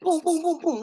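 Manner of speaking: high "boom" knocks on a door; imitated knocking. Somebody knocking on his door, high imitated thuds. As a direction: mimic insistent knocks in a high voice. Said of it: "knocking on the door".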